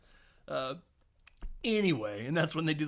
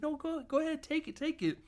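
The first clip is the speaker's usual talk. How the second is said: voiced fiancée; 13 semitones higher than usual. Voicing woman on the phone.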